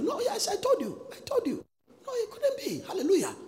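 high, laughing exclamations. Real, not acted. Preacher laughing at his own point to the church. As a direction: burst out laughing, voice jumping up.

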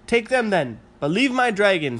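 high, loud pleading. Acted. Rory begs Mum to spare dragon, high loud plea. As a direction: beg to keep the dragon. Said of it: "said Rory".

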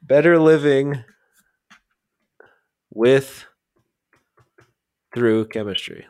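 "Better Living" high and loud, then long pauses; word-by-word, hanging on "with" before "Through"; halting title recall. Real, not acted.

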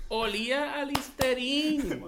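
"Olía a Listerine" stretched slow and high; "Olía a Listerine" high and slow, every word milked; drawn-out emphatic repeat. Real, not acted. Repeats the absurd detail emphatically.

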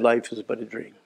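faint wistful grandmother; soft dreamy grandma. Retold or imitated. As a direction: let it trail off into nothing. Grandma's last refrain.

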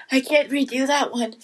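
usual speaking voice

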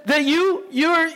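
usual speaking voice

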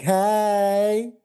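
loud high brash Hey, put on; Tiffany Pollard impression. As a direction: blast it out like a reality star. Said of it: "They're like".

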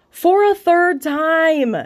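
slow, exaggerated self-mocking announcement; mock dramatic reveal. Real, not acted. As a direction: announce it like a mock trailer line. Mocks herself, genius.

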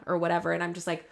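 ordinary delivery

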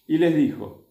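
ordinary talk, nothing marked

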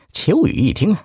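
ordinary delivery, nothing marked

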